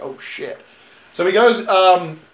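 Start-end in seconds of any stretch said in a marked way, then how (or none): 0.0-0.6 soft unvoiced gasp of dread; whispered dread. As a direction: breathe it out in awe. Audience reaction to sniper rifle.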